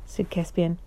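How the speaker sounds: plain reading voice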